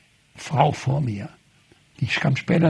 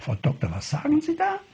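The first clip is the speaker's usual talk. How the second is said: taken aback; 10 semitones higher, 5 dB quieter than usual. Retold or imitated. Priest indignant at being called liar.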